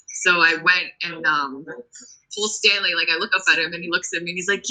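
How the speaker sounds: usual speaking voice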